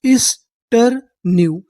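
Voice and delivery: Easter new said slowly, part by part; slow sounding out. Shown as a demonstration. Teacher joining syllables for students, slow. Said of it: "इसे पढ़ना है यहाँ पे new".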